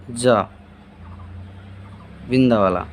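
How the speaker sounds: "जा" as a z-like sound, said low; letter sound demo. Shown as a demonstration. Teacher demonstrates "जा" as za for students. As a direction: give the dotted "जा" as za. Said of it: "इसका उच्चारण हो जाएगा".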